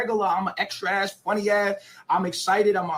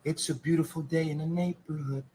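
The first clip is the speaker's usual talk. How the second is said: lower, softer sing-song gentle host; Mister Rogers voice; 4 semitones lower, 6 dB quieter than usual. Pretend. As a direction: croon it like a kids' TV host. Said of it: "like Mister Rogers".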